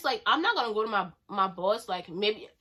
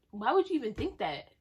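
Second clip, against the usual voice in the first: ordinary talk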